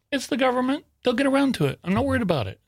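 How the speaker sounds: breezy high-pitched self-quote, shrugging it off; unbothered shrug. Retold or imitated. Author recalls shrugging off the government delay, breezily unworried. Retelling his own unworried attitude.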